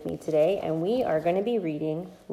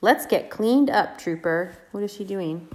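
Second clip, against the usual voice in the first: gentle singsong read-aloud; reading to a toddler; 3 semitones higher than usual. Acted. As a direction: read it sweetly to little kids. Reads picture book to toddlers.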